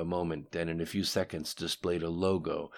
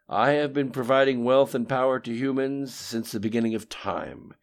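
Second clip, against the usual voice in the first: lightly voiced imperious power, louder; disappointed mastermind; 5 semitones higher, 7 dB louder, faster than usual. A narrator's reading. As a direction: voice a long-disappointed master, pushing the volume.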